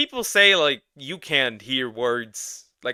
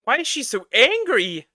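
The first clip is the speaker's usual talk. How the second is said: much higher and louder, mocking bewilderment; high-pitched mock confusion; mock squeaky voice; 12 semitones higher, 8 dB louder than usual. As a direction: squeak it out like a baffled cartoon. Mocking the angry chat girl.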